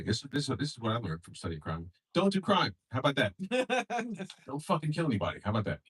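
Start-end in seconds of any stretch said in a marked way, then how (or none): none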